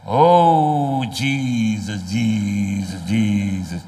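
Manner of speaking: drawn-out pleading Jesus, fading low; mock emotional prayer. Mocking a worshipper calling Jesus in English. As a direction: ham up a swooning worshipper. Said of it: "itu bisa".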